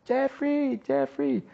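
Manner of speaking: high mock call of the name; mock yelling wife. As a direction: imitate her nagging call. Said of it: "Me grita a mí".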